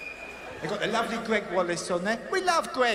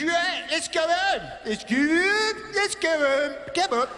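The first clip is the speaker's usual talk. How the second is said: mock Greg Wallace; 9 semitones higher, slower than usual. Comic as Greg herding the family home. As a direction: round them up cheerfully. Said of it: "He goes".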